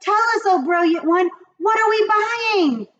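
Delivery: very high, fast, loud; begging witches. Acted. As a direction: plead like an excited coven. Witch chorus.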